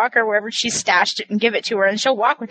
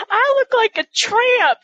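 high, slow mock lament; dismayed Alma; 11 semitones higher than usual. Teller imitating Alma in her nightdress, dismayed. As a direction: draw out a scandalized complaint, pitched high.

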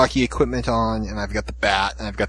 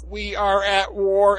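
higher, very slow, pompous alien declaration; alien light voice; 8 semitones higher, much slower than usual. Retold or imitated. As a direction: declare war slowly like a cheesy sci-fi villain. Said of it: "the Christmas tree light is like".

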